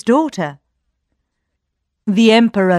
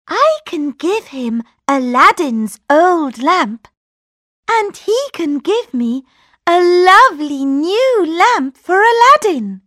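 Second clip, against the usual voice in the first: put-on high young princess; eager princess plotting; 9 semitones higher than usual. A narrator's reading. Princess thinks aloud.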